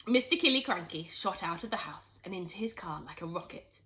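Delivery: plain reading voice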